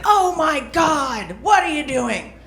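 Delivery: high, hammed-up driver yelling; mock scream. Comic imitating the Lyft driver freaking out, hammed-up high yell. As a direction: play the driver freaking out, half-mocking. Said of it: "starts screaming".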